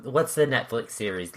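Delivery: ordinary talk, nothing marked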